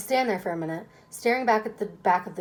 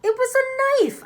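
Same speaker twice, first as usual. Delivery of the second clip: high, loud insistence; insistent; 6 dB louder than usual. Acted. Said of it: "I insist".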